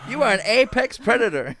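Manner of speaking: loud, high, hammed-up pep talk; goading. Co-host acts out demanding a jaguar roar, hammed-up goading. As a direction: goad them like a pushy coach.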